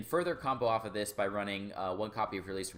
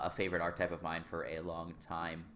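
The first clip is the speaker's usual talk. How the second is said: ordinary talk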